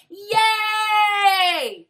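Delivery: high, loud shouted "Yay!"; excited cheer. Retold or imitated. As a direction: shout it with overblown glee. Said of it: "Whoa, she's so excited".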